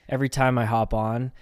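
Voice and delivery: ordinary delivery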